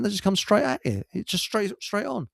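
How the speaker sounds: usual speaking voice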